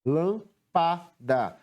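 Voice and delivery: "Lâmpada" very slowly, a pause after each syllable; separando sílabas. Shown as a demonstration. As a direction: break "Lâmpada" into three separate beats. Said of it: "Como é que separamos a palavra lâmpada?". ("Lâmpada" pausado, sílaba por sílaba)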